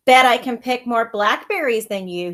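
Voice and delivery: a touch of a jeer. Acted. Brother taunting his little sister at the berry patch, mild jeer. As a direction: taunt your sister lightly. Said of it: "he jeered at me one day".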